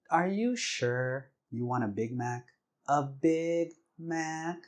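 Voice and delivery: slow, drawn-out cutesy question. Retold or imitated. Wife asking husband a third time, cute and slow. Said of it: "with her cute voice and everything".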